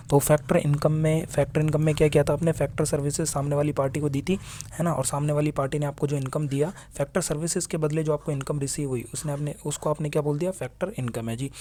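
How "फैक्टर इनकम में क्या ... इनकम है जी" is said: ordinary delivery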